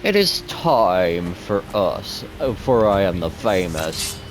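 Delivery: slow, grandly stretched vampire voice; classy Dracula voice. Shown as a demonstration. Streamer demonstrating his vampire voice to viewers, classy slow Dracula. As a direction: introduce yourself as a classy, accented vampire, taking your time. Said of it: "I do a pretty good Dracula voice".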